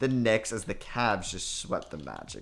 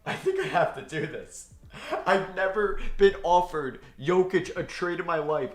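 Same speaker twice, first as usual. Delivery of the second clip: laughing in disbelief; 6 semitones higher than usual. Real, not acted. Gamer offered Jokic in a trade, laughing in disbelief. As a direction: giggle through the words.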